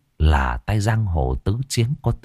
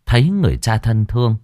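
ordinary delivery

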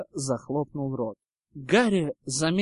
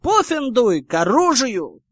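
high, loud battle rally; shout; 7 semitones higher, 8 dB louder than usual. A narrator's reading. Ernie rallying Hufflepuff to arms, shouting. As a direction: yell it, ending on a war cry. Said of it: "закричал Эрни".

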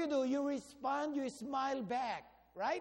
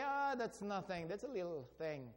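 softer, lower, dismissive aside; modest brush-off; 4 semitones lower, 7 dB quieter than usual. Acted. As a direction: play down the praise quietly. Acts out people brushing off praise.

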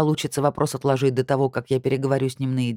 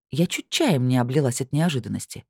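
plain reading voice

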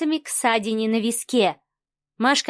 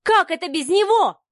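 high-pitched indignant question; louder outcry; 7 semitones higher than usual. A narrator's reading. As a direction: raise the voice and pitch in protest. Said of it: "а потом уже громче". (громче, возмущённый вопрос)

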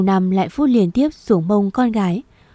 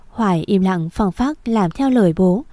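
usual speaking voice

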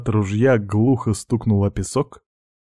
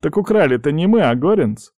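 lively, pitched-up counterargument; reasoning objection; 6 semitones higher, 3 dB louder than usual. Acted. Pirate defending keeping the rifle, reasonable objection. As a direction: object as if it were obvious. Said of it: "резонно возразил Дерек".

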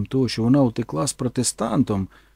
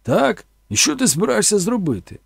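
higher, louder mocking dare; taunting; 7 semitones higher, 4 dB louder than usual. Boss taunts Forrest, mocking challenge. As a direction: sneer at the threat. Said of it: "а він відповів".

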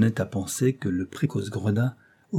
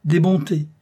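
higher, louder mocking exclamation; sly, odious mockery; 8 semitones higher, 7 dB louder than usual. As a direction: jeer at it like a crafty rascal. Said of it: "de sa voix la plus odieusement rouée".

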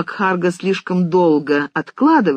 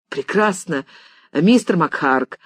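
usual speaking voice

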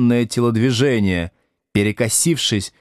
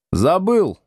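cheeky, higher-pitched answer with a smirk; 9 semitones higher than usual. A narrator's reading. Pupil claims he forgot his own surname, smirking. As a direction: toss it back with a smirk. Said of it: "ухмыльнулся он".

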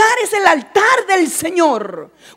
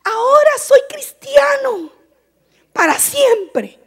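put-on child's excited cry; 8 semitones higher than usual. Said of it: "y dijo".

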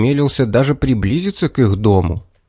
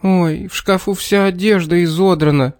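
tearful; 7 semitones higher than usual. A narrator's reading. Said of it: "заплакала мама".

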